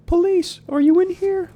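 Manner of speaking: very high, loud call into the dark. Acted. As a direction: call out for the cops. Calling out to the police.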